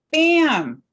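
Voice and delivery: loud, high burst; loud exclamation. Acted. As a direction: hit it like a sudden flash, loud and high.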